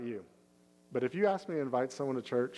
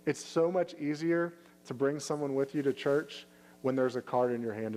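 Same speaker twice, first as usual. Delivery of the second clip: ordinary delivery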